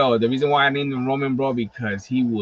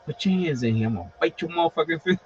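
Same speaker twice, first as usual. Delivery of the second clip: soft, menacing pet voice, quieter and tough; voicing the dragon; 5 dB quieter than usual. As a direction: give the angry lizard a hushed street threat. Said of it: "she's like".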